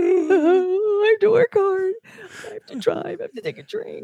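high, slow fake sobbing, fading away; mock crying whine. Host mocking the commuter girl, fake crying. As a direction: blubber it like a spoiled crybaby.

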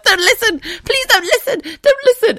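very high, loud, laughing squeal; shouted plea. Retold or imitated. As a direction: beg at the top of your voice, cracking up. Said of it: "I actually shouted".

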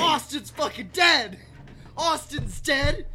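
high, loud, drawn-out exclamation; mock shock. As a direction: slowly wail the news out. Listener exclaims at character's death.

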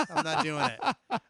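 laughing, slowly drawn out; laughing refusal. Real, not acted. Telling a friend he won't share the seat, laughing.

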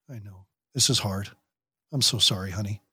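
gentle quiet reassurance to daughter; soft comforting. Retold or imitated. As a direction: hush and comfort your child. Parent comforting the teen.

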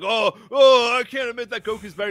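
high, loud wail then rushed complaint; mock whining. Fan mocking a Vegeta who won't admit Goku's better, whiny. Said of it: "whiny bitch and be like".

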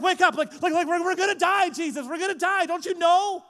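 high, loud, frantic pleading; panicked shouting. Retold or imitated. Disciples begging Jesus to wake up, panicked shouting. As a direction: beg him to wake up, terrified.

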